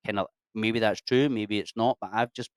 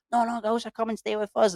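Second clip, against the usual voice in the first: high, eager host, acted; playfully imitated high-pitched welcome; imitated insistent invitation; 12 semitones higher than usual. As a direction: brush off their plan and insist they stay. Acting host insisting on hospitality.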